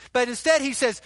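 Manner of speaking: ordinary talk, nothing marked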